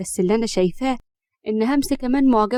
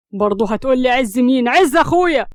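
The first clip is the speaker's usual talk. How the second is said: exasperated; 2 semitones higher, 5 dB louder than usual. A narrator's reading. Acted quarrel over Ezz.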